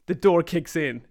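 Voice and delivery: ordinary delivery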